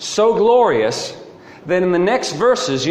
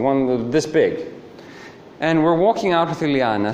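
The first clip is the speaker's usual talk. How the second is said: ordinary talk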